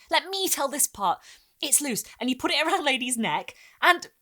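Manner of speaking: high, loud, racing to tell; eager boy. Acted. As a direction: grab the story from your sister.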